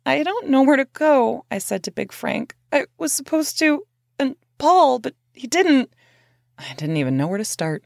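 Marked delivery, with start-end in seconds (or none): 0.1-5.9 higher, upset and rushed; distressed. Retold or imitated. To Big Frank, stranded at night, distressed. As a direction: fragments tumbling out in distress.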